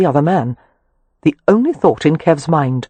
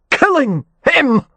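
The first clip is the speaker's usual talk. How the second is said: slow, strained grunt; grunted snarl; 10 semitones higher, slower than usual. A narrator's reading. Kev pinned to the floor, grunting his answer in fury. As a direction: force it out through effort. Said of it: "Kev grunted".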